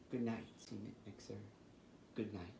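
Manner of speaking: soft sleepy goodnight dying away; fading goodnight. Acted. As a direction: tuck them in with a hush. Bedtime goodnights.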